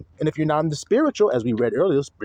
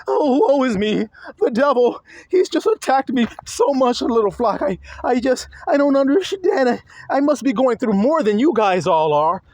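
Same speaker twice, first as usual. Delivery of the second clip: high, louder mock lament; whining prophet; 9 semitones higher, 3 dB louder than usual. Preacher mocking a prophet whining to his little flock. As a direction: play a self-pitying prophet moaning to his flock. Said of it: "standing in front of them saying".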